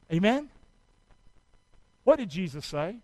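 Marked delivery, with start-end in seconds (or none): none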